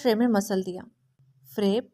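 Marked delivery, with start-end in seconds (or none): none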